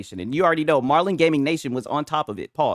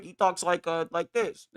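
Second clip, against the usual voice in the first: mock a drowning-sounding talker, quietly; drowning man impression; 6 dB quieter than usual. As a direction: softly imitate someone who talks like he's drowning. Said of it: "like he's drowning".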